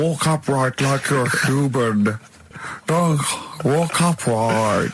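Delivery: slow, drawn-out cow voice; scheming cow. Acted. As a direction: cows urging each other in a put-on voice. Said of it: "And the cows were going".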